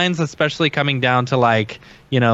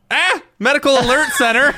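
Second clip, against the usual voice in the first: startled shout; 11 semitones higher than usual. Real, not acted. Surprised by the hotline greeting.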